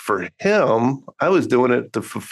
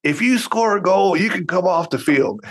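mock dad yell; 8 semitones higher than usual. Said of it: "and I yelled at him".

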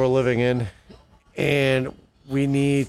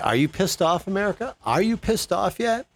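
rising, much higher, demanding an answer; indignant challenge; 7 semitones higher than usual. Real, not acted. Vlogger challenging viewers across America, indignant rising voice. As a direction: rile up the viewers, pitch climbing each time.

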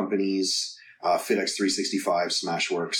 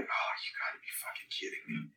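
breathless whisper of disbelief; 14 dB quieter than usual. Retold or imitated. Son stranded at the garage after dad's death, whispering in disbelief. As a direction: whisper it, stunned and defeated.

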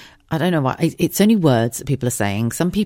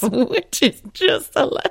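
higher, slow, laughing disbelief; 10 semitones higher, slower than usual. Real, not acted. Telling the listeners about the chicken's head, laughing. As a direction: giggle through the words.

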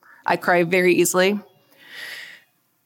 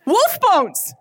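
put-on much higher kid shout of "Wolf bones!"; excited kid; 10 semitones higher than usual. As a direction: burst out "Wolf bones!" like a thrilled boy. Son's excited exclamation retold.

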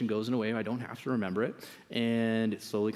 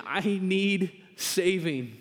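slow punchline; 6 semitones higher, much slower than usual. Acted. Punchline after awkward story.

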